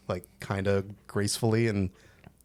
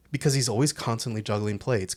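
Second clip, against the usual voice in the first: ordinary talk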